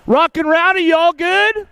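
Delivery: 3 call out to the rider to check he's okay. Real, not acted.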